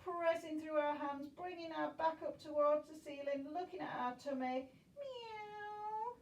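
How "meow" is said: high, soft, rising kitten meow; mewing like a cat. Pretend. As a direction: squeak it out like a tiny cat. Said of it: "Back into our cat".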